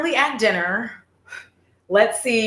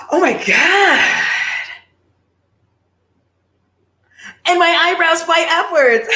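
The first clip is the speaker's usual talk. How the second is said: hammed-up shock; 7 semitones higher, 6 dB louder than usual. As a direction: play the shock big for the camera. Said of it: "And they did fly upwards. I'm a good actress".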